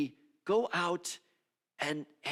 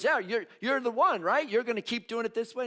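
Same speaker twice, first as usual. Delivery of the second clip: high, louder questioning voice; John's challenge; 9 semitones higher, 6 dB louder than usual. Acted. John pressing Jesus with a high, raised question. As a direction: press the question, a bit incredulous. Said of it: "And John says to Jesus".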